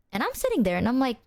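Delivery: normal talking voice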